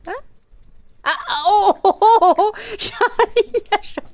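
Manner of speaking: oh aigus entre les rires; s'exclame en riant, très haut; exclamation amusée. Real, not acted. (exclamations aiguës en riant)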